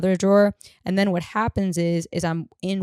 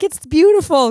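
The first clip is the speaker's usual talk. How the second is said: mock baby talk to a dog, high and loud; imitating the older guy's high sugary dog voice; high cooing to a dog; 10 semitones higher, 7 dB louder than usual. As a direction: coo at the dog like a sweet old man. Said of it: "he's like".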